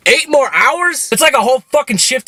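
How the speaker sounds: half-sobbed high protest; crying disbelief. Retold or imitated. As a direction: cry out the words in despair. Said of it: "I fucking started crying".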